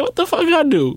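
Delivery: high-pitched baffled protest; mock bewilderment. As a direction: imitate a wrongly accused friend, voice way up. Acting the baffled friend.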